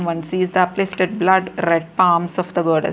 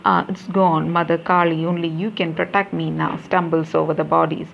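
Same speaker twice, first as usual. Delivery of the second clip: plain reading voice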